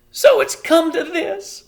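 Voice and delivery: high, loud, slow lament; despairing. A narrator's reading. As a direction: wail over it. Homily despairing.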